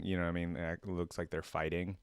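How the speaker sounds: ordinary talk, nothing marked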